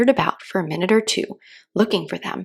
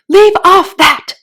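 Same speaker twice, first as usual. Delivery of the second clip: high, loud, slow scream; 11 semitones higher, 12 dB louder, slower than usual. A narrator's reading. Queen screaming at bowing gardeners. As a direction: scream it in exasperation. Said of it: "screamed the queen".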